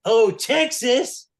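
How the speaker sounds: high, loud, slow call across the room. Retold or imitated. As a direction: stretch the name out, summoning him. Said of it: "And Paul's like".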